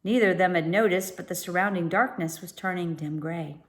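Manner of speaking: ordinary delivery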